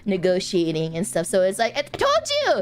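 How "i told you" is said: mock hype. Mock bragging about a world tour.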